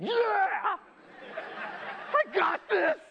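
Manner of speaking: high, loud, laughing yell of triumph; pumped-up gym yell. Retold or imitated. Lifter yelling back at his spotter, laughing and pumped. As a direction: shout it like you just found your strength. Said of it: "I'm like".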